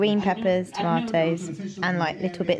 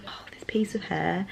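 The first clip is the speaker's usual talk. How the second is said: whisper; 7 dB quieter than usual. Real, not acted. Aside about stray hair.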